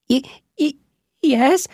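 high squeaky stammer, scared; stammering mouse. Acted. Mouse Bobbin agreeing to the witch, scared stammer. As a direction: stutter the yes like a frightened little mouse. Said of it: "Bobbin said".

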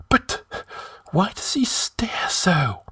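high, frightened whisper. A narrator's reading. High Counselor backing from the staring corpse, whispering. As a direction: whisper it slowly, drawing away. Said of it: "whispered Publius".

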